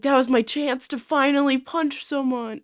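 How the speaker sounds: high, loud and eager; excited. Retold or imitated. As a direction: blurt it out with glee. Excited inner thought.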